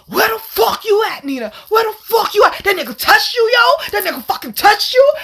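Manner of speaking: homeboy on the phone raging at the guy who touched her; mock furious yell. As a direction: yell it like an angry friend on speaker. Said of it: "my homeboy, he on the phone".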